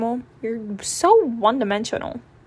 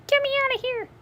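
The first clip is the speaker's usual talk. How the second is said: very high squeaky mouse voice; tiny mouse cry; 14 semitones higher, faster than usual. Acted. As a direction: squeak it from inside the vacuum. Mouse trapped in vacuum.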